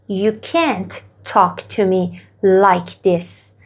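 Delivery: very slow, each word spaced out for learners; slow model sentence. Shown as a demonstration. Teacher modelling the answer very slowly for students. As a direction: say it slowly so students can repeat. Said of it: "حالا اینو شما بگید".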